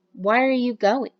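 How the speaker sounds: "going" falls at the end; falling question tune. Shown as a demonstration. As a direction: ask it with the pitch dropping. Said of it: "see how that has a falling intonation".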